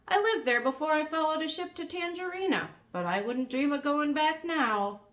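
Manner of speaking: put-on high, loud old bird voice; crusty old seagull; old gull voice.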